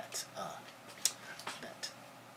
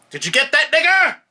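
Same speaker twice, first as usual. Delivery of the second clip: screamed at the top of the lungs, far higher; police officer's shout; 22 dB louder than usual. Retold or imitated. Lawyer reenacting the officer's taped shout for the judges, full-force scream. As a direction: bellow it like a violent cop on tape. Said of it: "I said".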